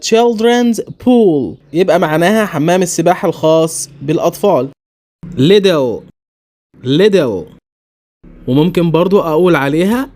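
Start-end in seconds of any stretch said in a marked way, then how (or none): 5.2-7.5 little with a flapped t, American. Shown as a demonstration. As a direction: say little like liddle, American accent. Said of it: "الأولى بالأمريكي". (little بالأمريكي)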